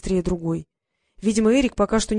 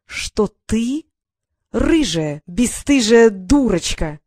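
louder, slow taunting punchline; mocking yell; 2 semitones higher, 6 dB louder, much slower than usual. Brother springs insult on sister, loud slow taunt. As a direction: hit the insult hard and slow.

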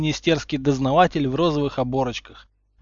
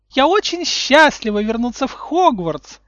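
put-on much higher and louder, sugary little-girl voice; girlish high voice; 12 semitones higher, 8 dB louder than usual. A narrator's reading. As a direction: chirp it like a sweet girl. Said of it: "высоким девичьим голоском". (высоким девичьим голоском)

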